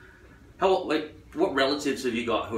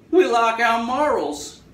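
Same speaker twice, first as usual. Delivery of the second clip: loud, high, drawn-out caricature; mock moralist; 10 semitones higher, 6 dB louder, slower than usual. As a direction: proclaim it in a silly high voice. Voices the moral side against nature.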